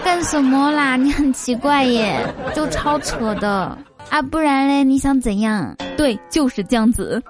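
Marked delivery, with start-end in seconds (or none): 0.0-5.8 lilting Taiwanese accent. Shown as a demonstration. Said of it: "接下来我举几个例子哈".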